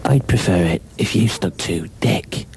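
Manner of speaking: whispered commentator voice; hushed snooker commentary. Acted.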